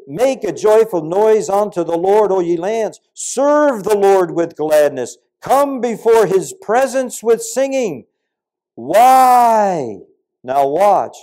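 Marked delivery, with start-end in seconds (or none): 0.1-8.0 loud, high, slow psalm proclamation; preacher proclaiming Psalm 100 to his congregation; full-force preaching. Acted. 8.7-10.0 loud, high drawn-out why; preacher shouting a question to the crowd; shouted question. Acted.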